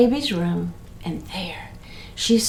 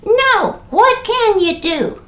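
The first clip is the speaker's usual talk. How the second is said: put-on baby voice; 11 semitones higher, 4 dB louder, slower than usual. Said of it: "And the baby said".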